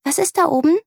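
put-on high, curious little girl; girl asking what her friend is staring at, high and curious; curious child. A narrator's reading. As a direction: ask with wide-eyed interest. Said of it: "will sie wissen".

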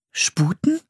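SPUTEN asked high with a rising tune; questioning. A narrator's reading. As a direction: query the word "Sputen" in a high voice. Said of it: "fragte Anne". („Sputen“ hoch und fragend)

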